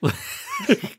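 short squeaky burst of laughter; quick high-pitched laugh. Real, not acted. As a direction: let out a high yelp of a laugh. Laughing at friend's joke.

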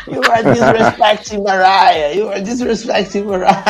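imitação lenta e arrastada da velha; imitando a mãe da Mariah. Retold or imitated. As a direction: arrasta as palavras como a velha. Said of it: "A mulher só repete isso". (imitação lenta da mãe repetindo a acusação)

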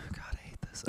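very soft, whispered aside; real whispered exasperation; whispered dismay. Real, not acted. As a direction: sigh it under your breath in dread. Reader groaning aside at the story.

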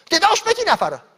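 loud, high angry threat. Retold or imitated. Woman threatening to throw the pastor out, loud and angry. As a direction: fling the threat at him.

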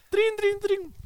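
very high, loud trin trin mimic; phone ring imitation. Narrator mimics the haunted phone ringing, high.

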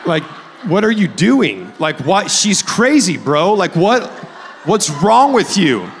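yelling at the TV. Retold or imitated. Pastor shouting at a Bachelor contestant on the TV. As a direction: plead with the TV as if Peter can hear. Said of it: "and I'm like".

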